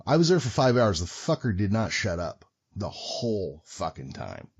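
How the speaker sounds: ordinary talk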